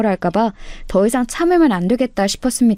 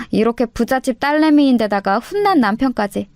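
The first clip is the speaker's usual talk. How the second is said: plain reading voice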